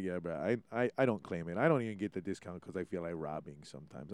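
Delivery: low, soft mumbled aside, imitating a modest local; humble decline. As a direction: quote them quietly, almost under the breath. Quotes modest locals declining discount.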